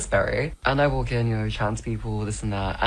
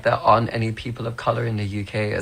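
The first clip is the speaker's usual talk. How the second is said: ordinary talk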